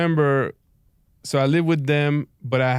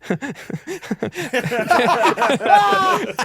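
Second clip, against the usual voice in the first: 1 nervous high laugh; 12 semitones higher than usual. Acted.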